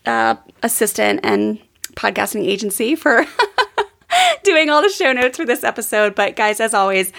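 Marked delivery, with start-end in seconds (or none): 3.3-3.8 brief high-pitched laugh; short laugh. Real, not acted. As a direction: laugh midway through the thanks. Laughs while thanking assistant.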